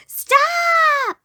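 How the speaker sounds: high, loud shriek of a crowd of pests; screaming guests. Acted. Guests scream at their host to stop, high shriek. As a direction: shriek it at the moose. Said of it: "screamed his guests".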